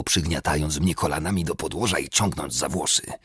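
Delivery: ordinary delivery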